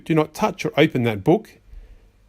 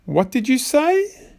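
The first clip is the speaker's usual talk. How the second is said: incredulous; 9 semitones higher, slower than usual. Acted. Disbelief at child.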